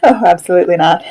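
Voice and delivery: loud amused no, breaking into a laugh. Real, not acted. [name] laughing off Mike's joke about the kitchen, laughing. Joking about feeding screaming kids.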